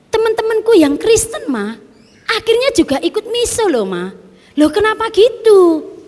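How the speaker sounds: put-on high voice of an eleven-year-old daughter; child's voice. Mother quoting her daughter, high childlike.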